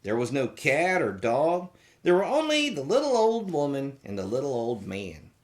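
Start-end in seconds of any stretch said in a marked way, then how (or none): none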